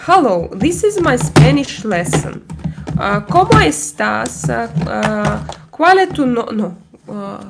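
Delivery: bad Spanish in a thick Slavic accent. Prank caller speaking to a scammer, broken Spanish in a Slavic accent. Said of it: "with horrible Slavic accent".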